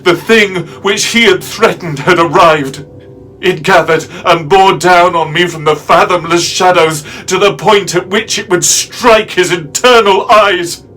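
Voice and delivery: loud, high, intense dramatic narration; desperate climax. A narrator's reading. Narrator with back against barred doors, desperate climax. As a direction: cry it out at full pitch, cornered and defiant.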